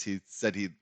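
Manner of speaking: usual speaking voice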